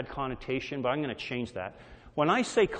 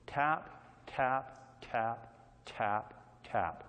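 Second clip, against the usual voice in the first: soft tapping; 5 semitones lower, 9 dB quieter, much slower than usual. Acted. Hammer tapping the rock.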